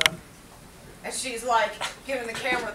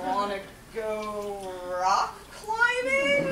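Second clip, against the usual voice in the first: very slow, drawn-out hesitant question; tentative suggestion; 2 semitones lower, much slower than usual. Acted. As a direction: stretch it out as if unsure it will land. Backpedalling with a tentative date suggestion.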